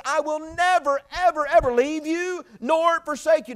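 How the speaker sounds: loud, high, slowed, every word weighed; God's promise preached. Acted. Preacher quoting God's promise to the church, slow and loud. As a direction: proclaim it slowly with full force. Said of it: "He says".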